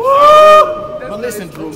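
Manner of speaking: huge stretched-out hype shout; energy drink ad. Acted. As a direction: stretch it out, yelling high and loud. Hype shout in rap battle.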